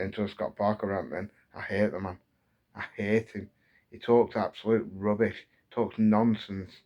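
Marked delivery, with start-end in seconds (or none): none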